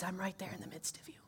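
breathy hushed whisper. Acted. Pastor voicing God softly to the congregation, whispered.

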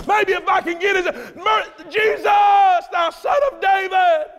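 rising to a high, loud plea; building shout. Acted. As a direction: push it until it bursts out.

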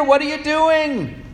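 put-on high, slow childish whine; complaining child. Preacher voicing humanity as a child complaining to God, high whine. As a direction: whine it like a hurt kid. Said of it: "From our point of view".

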